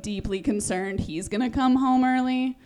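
normal talking voice

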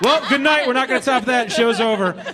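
high, loud laugh-filled wrap-up. Real, not acted. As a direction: crack up while signing off. Host laughing after joke.

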